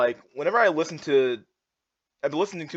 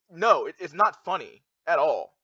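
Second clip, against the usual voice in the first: ordinary talk, nothing marked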